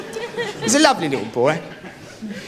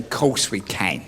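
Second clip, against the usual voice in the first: weary; 14 semitones lower, 6 dB quieter, slower than usual. Retold or imitated. Husband reply, low and slow.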